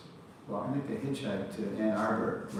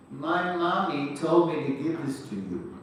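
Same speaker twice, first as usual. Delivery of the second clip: put-on higher, louder little boy; light childish voice for a little boy; 5 semitones higher, 6 dB louder than usual. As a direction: chirp it like a small child. Little boy quoted in story.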